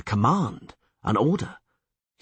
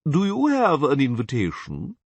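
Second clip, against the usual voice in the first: slow, higher pompous official; haughty chamberlain; 5 semitones higher, 3 dB louder than usual. A narrator's reading. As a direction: look down your nose.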